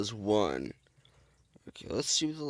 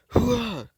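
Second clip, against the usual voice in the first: loud gung-ho soldier; 11 semitones higher, 4 dB louder than usual. Retold or imitated. Soldier battle cry.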